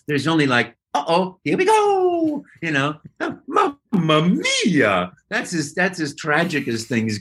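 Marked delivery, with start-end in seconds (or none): 0.9-2.4 high, slow cheerful Mario; bouncy Italian plumber voice. Retold or imitated. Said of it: "there is no tragedy in Mario". 3.2-5.1 slow, high mock-dismayed Mamma Mia; drawn-out cartoon plumber dismay; Mario voice. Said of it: "there is no tragedy in Mario".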